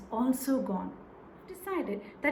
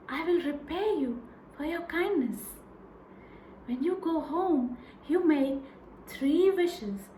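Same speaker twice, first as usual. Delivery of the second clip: eager fairy; 4 dB quieter than usual. Acted. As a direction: call out, then promise the wishes. Fairy promises three wishes.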